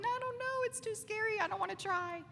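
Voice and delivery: high, timid whine of a scared gremlin; scared inner gremlin. Retold or imitated. Preacher voicing the fearful gremlin in our heads, high and timid. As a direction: shrink back like a frightened little voice inside.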